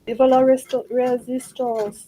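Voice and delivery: mock French rallying cry; hammed-up French accent, drawn out at the end; terrible French accent. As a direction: declare it in a bad French accent. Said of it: "my terrible French accent".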